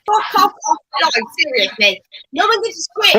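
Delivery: furious, loud, high yell; angry shouting. Real, not acted. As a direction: tell him off at full volume. Host yelling at caller.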